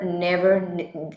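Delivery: ordinary talk, nothing marked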